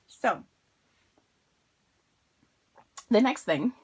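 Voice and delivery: talking through peanut butter; words round a mouthful; mouth full. Real, not acted. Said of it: "I have peanut butter in my mouth".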